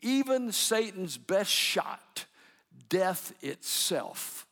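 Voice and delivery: drawn-out, word by word, for emphasis; slow it right down and land each word; emphatic slow preaching. Acted. Preacher closing the sermon on God's faithfulness, very slow and emphatic.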